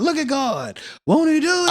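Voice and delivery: high-pitched churchgoer's exclamation; church member exclaiming. Acted. Friend imitates someone at church when the preacher says something, high-pitched exclamation. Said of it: "at church ... somebody might be like".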